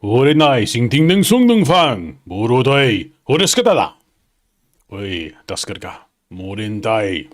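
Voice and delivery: loud, swooping samurai-film Japanese, easing off at the end; hammed-up gibberish Japanese; mock Japanese. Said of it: "I can do a little bit of Japanese".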